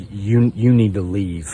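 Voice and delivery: slow, understated retold warning; serious order. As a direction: imitate a stern dismissal without raising the voice. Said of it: "I could tell she was serious".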